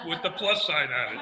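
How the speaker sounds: normal talking voice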